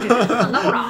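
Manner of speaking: laughing mock yell; mock angry shout. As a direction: shout it while cracking up. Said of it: "可愛いな".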